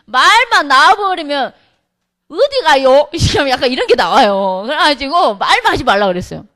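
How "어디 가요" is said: put-on Yeosu island accent, lightly louder; Yeosu island dialect. Deaconess asking a bar worker, in Yeosu island dialect. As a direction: drop into a Yeosu island twang. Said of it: "여수 섬이라".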